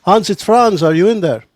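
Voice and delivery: high, loud, bad German accent; hammy German accent, calling through a door. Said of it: "Pardon my horrible German accent".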